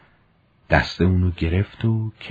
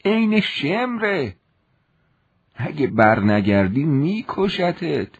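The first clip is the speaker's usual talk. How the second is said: put-on high, slow old woman's voice; old servant woman warning the young soldier, high and slow; old maid warning; 8 semitones higher, 3 dB louder than usual. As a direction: urge him to beware, slowly. Old maid warns him.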